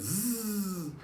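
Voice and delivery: a fast buzzing zzz like a line running out; reel buzzing noise. Retold or imitated. Fishing line screaming off the reel, imitated buzz. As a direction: buzz through the teeth like a reel spinning. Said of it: "all you could hear was".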